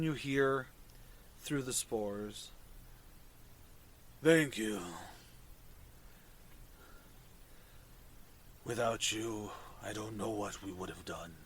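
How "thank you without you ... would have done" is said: low, soft fungal creature voice; mushroom voice. Acted. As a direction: speak low and hushed like a mushroom.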